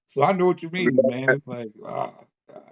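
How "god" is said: ordinary delivery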